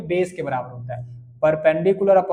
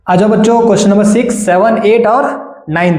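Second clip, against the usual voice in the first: loud, rousing classroom call; 8 dB louder than usual. Real, not acted. Teacher summoning students to the next questions, calling out.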